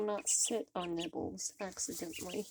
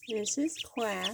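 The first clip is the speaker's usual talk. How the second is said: ordinary talk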